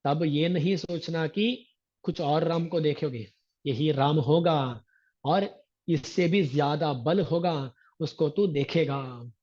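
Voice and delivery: ordinary talk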